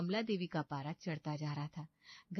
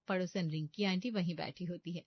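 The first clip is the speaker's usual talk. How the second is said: usual speaking voice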